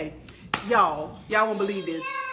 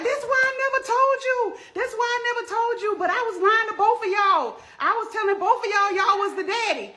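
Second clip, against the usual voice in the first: shrill confessing mom; 12 semitones higher than usual. Retold or imitated. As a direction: blurt the confession in a raised voice. Mom confesses after being hit.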